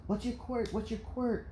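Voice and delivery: put-on high, soft, quick children's voices; curious preschoolers. Classmates asking Izuku about quirks, curious children. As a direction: chatter it like eager little kids.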